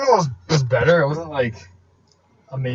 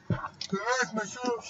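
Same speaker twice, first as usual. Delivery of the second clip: soft, drawn-out silly put-on voice; mock customer reply; 8 semitones higher, 13 dB quieter, much slower than usual. Joking as a Big Mac customer answering, hushed silly voice. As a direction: answer slowly in a small hushed voice.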